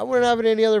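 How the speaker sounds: very high, loud mock joy; hammed-up sarcastic gushing; mock delight. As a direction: sing its praises sarcastically, pitch way up.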